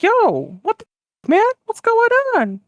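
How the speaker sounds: high-pitched comic disbelief; hammed-up bewildered interjection; baffled outburst. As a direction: butt in, voice shooting up high. America interrupts the acted quarrel.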